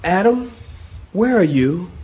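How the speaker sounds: tender call with a questioning lift; gentle searching God. Acted. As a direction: call out gently to someone lost. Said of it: "I get more of a tone of".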